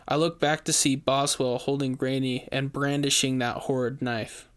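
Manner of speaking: plain reading voice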